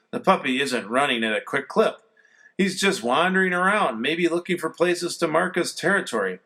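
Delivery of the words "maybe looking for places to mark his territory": ordinary delivery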